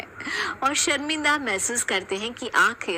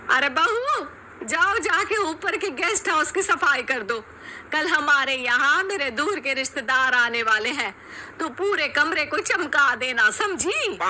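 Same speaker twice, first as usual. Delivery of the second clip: higher commanding voice; bossy mother-in-law; 5 semitones higher than usual. Acted. Mother-in-law ordering the bahus to clean, bossy.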